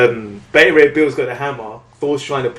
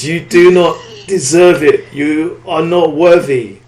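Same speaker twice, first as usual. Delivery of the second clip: grand hero voice; 6 semitones higher, 5 dB louder than usual. Acted. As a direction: pronounce judgment like a god. Thor's grand pronouncement, comic-book style.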